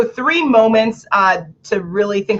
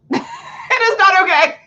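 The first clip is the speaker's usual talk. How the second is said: It is NOT okay, laughing, higher; laughing emphasis; 11 semitones higher than usual. Real, not acted. Laughing as she warns the audience off um. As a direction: stress NOT through a laugh. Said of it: "laughing emphasis".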